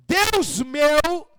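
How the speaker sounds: loud, high, drawn-out cry; ringing exclamation of devotion; calling to God. Acted. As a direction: call upward with full voice. Acting the call to God.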